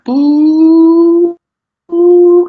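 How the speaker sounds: loud, high ringing tone; imitated ringing. Describing a ringing in his ears during a trip, loud and high. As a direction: sing out a piercing high ring. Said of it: "I started to hear this ringing".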